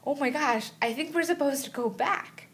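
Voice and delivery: high, startled realization; sudden panic that she's been left out in the ocean; alarmed. Retold or imitated.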